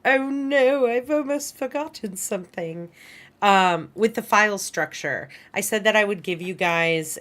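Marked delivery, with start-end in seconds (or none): 0.0-2.9 high-pitched, drawn-out mock panic; mock dismay. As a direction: wail a little "oh no" at your own forgetfulness. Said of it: "I'm always like".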